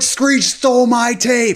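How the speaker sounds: slow, high accusing yell; shouting while being hauled off; mock shout. Yelling while being taken away.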